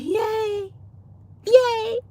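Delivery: high cheering yay; excited yay. Retold or imitated. Retelling her joy at the hiring email. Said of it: "So I was like".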